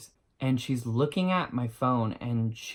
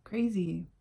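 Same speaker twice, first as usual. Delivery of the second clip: sarcastic friend; 7 semitones higher, 4 dB quieter, much slower than usual. Retold or imitated. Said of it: "looks at me and goes".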